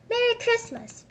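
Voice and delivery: high, loud festive call; cheery greeting. Acted. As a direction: hail the clerk merrily. Nephew calls greeting to clerk.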